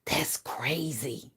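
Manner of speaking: breathy whispered aside, slow; softly whispered, slowed disbelief. Real, not acted. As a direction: lean in and whisper it. Whispered aside after DNA boast.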